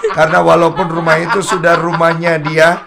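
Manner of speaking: husband laughing as he recalls the house. Real, not acted. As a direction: laugh the words out. Laughing while telling the fight.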